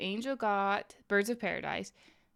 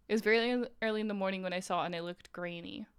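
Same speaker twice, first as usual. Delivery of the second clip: ordinary talk, nothing marked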